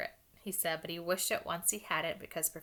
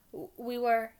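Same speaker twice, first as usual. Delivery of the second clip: higher, louder false start; flustered stammer; 5 semitones higher, 7 dB louder, slower than usual. A narrator's reading. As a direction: start stammering with no excuse ready. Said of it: "Harry began without any idea what he was going to say".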